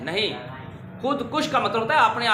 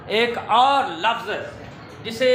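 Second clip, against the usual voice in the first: usual speaking voice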